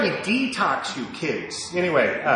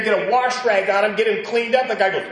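usual speaking voice

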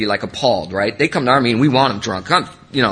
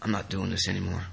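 choked-up quiet; 4 semitones lower, 12 dB quieter than usual. Real, not acted. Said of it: "without getting emotional".

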